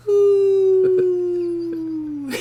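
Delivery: high mock owl hoots; owl hooting. Caller hooting down the phone at him, high owl hoots. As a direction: hoot it like a drunk owl. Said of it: "Got a fucking owl on the phone?".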